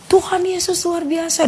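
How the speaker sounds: high, slow exclamation without the volume; retold cry. Neighbour standing in the room crying out praise, retold cry. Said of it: "Dia teriak".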